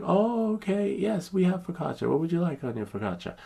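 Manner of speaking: very high sing-song clerk; mock clerk. The clerk finally getting the order, high sing-song. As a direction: ham up the sudden understanding. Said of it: "I was like".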